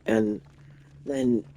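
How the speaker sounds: ordinary talk, nothing marked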